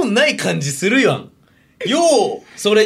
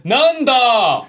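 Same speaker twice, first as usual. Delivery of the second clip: raised, drawn-out mock challenge; irate older man; 6 semitones higher, 3 dB louder, much slower than usual. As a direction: play a commuter spoiling for a fight. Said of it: "ちょっとおじさんやって".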